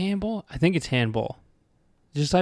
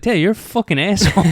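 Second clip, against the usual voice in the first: retold angry outburst; 9 semitones higher, 5 dB louder than usual. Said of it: "I was like".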